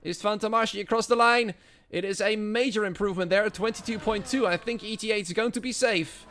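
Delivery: high, loud play-by-play; live race commentary. Acted. Commentator calling the final cars across the line, excited play-by-play.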